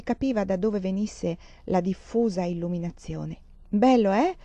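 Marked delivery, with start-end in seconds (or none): 3.7-4.4 a touch of fatherly pride, a bit louder; proud. A narrator's reading. Father to Anna about the new furniture, mildly proud. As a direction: show off the new room, pleased with himself. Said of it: "fece il padre orgogliosamente".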